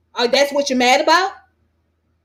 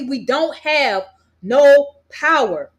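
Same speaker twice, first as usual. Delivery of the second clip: slow emphatic; much slower than usual. Real, not acted. Repeats his point for emphasis.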